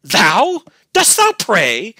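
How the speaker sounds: loud, high, drawn-out incredulous jeer; slow mocking question at a shout; fiendish scorn. A narrator's reading. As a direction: scoff savagely at the prayer. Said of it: "still with the same fiendish scorn".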